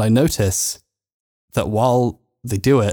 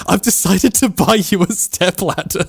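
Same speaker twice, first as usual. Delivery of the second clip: reading a message aloud, breaking into laughter; higher pitch, slowing down through the giggles; laughing read-out; 7 semitones higher, slower than usual. Retold or imitated. Reading friends a stepladder message, laughing.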